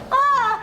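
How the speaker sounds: ticklish yelp. Real, not acted. Being tickled again.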